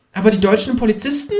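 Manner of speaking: high, fast kangaroo interjection. A narrator's reading. Kangaroo interjects to the narrator, high and quick. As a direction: cut in quickly. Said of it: "wirft das Känguru ein".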